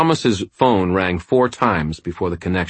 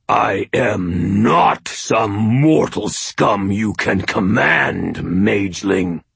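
slow, loud inhuman seethe; seething; 4 semitones higher, 4 dB louder, slower than usual. A narrator's reading. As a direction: spit out each word with contempt. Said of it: "Shagnasty seethed".

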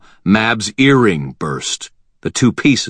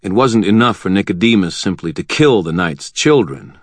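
ordinary delivery, nothing marked

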